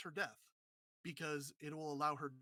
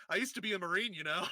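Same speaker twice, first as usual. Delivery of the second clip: louder, cocky retold line; tough retold boast; 8 dB louder, faster than usual. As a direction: belt it out like a proud ex-Marine. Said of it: "and she's like".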